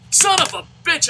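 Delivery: high, loud frustrated curse; frustrated outburst. Real, not acted. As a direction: blurt it out in disgust. Reacts to bad play.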